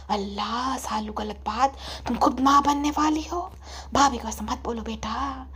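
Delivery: soft, low, placating murmur; pacifying mother. Acted. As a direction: gently talk your daughter down. Mother pleads softly. (समझाती अम्मी की धीमी आवाज़)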